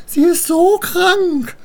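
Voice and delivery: very high, drawn-out squeal; mocking mimicry. Rhine daughters mock Annabel's worry, squealing slowly. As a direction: stretch it into a loud, squealing taunt.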